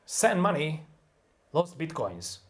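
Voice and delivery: "Send money, lost bitcoins" each word hit; content words only. Shown as a demonstration. Teacher strips function words, content words punched. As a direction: telegraphic stressed words. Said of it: "get rid of those function words".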